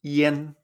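"yen" with a clear E, not A; Chinese yen. Shown as a demonstration. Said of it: "pronunciarlo con E".

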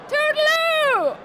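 a high, loud, slow sung-out toodaloo; gushing farewell. Acted. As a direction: stretch the goodbye out like a flourish. Sign-off of the radio review.

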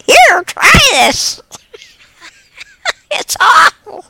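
loud, very high, drawn-out offer; hammed-up urging. Sharing awful food with someone, hammed-up urging through giggles. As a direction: squeal it out between laughs. Comic urging amid real laughter.

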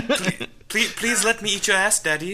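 put-on high-pitched, begging Victorian boy; young Victorian boy. Mocking the kink as a Victorian boy, high pleading voice. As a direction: plead like a hammed-up Victorian child. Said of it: "a young Victorian boy, just like".

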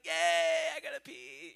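small cheer, then squeak out the complaint; unimpressed child. Retold or imitated. Daughter answers parent's excitement, high half-hearted cheer.

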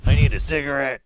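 dummy voice. Pretend. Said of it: "He'll be like".

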